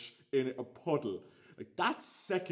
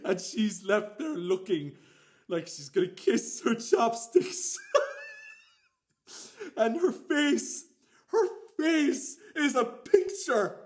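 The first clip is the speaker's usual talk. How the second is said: laughing; 10 semitones higher, 7 dB louder than usual. Real, not acted. Laughing at her dropping salmon.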